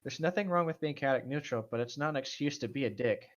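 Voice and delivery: usual speaking voice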